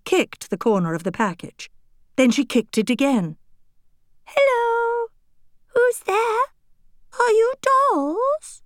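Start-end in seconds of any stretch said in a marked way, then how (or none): 4.3-8.6 much higher tiny voice, slowing at the end; a tiny voice from inside a box; teensy voice. Acted. Hidden doll questions the girls from the box. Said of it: "said the teensy voice".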